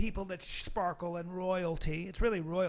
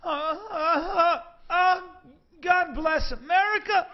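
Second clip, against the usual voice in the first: high, loud, moaning climax; buddy climaxing; 11 semitones higher, 9 dB louder, slower than usual. Retold or imitated. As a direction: moan it out loud, slowly. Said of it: "and he goes".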